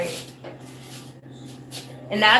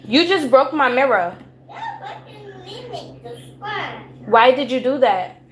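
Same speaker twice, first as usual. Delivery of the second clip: higher-pitched parental confrontation; real scolding; 5 semitones higher than usual. Real, not acted. Confronting child about broken mirror.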